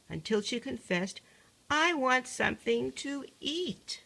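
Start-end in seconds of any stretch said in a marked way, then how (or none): 1.7-4.0 put-on higher, louder, slow childish whine; a hungry child wailing it out; whiny wail. Youngest daughter wails to her father, whiny and slow. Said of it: "she howled and she wailed".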